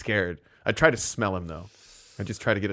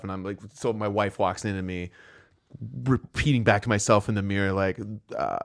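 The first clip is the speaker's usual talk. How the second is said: ordinary talk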